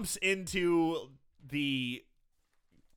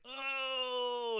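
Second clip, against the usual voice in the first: pained groan; 9 semitones higher, 4 dB quieter than usual. Retold or imitated. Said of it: "He's like".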